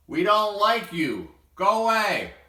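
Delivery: high, loud jeering shout. Acted. Zebra shouting at lonely Dexter to go away. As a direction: yell him away, mean and loud. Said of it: "The zebra shouted".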